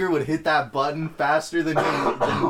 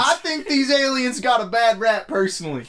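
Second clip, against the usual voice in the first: mock Republican voice; 9 semitones higher, 3 dB louder than usual. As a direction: play a folksy conservative. Voicing a Republican.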